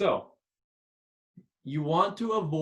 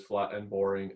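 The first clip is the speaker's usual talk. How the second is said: flat monotone; 10 semitones lower, 4 dB quieter than usual. Shown as a demonstration. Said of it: "you always just talk like this".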